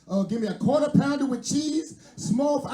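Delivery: put-on high, loud slurred drunk order; drunk girlfriend. Comedian voicing his drunk girlfriend, high and loud. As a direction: play the drunk girl barking a fast-food order.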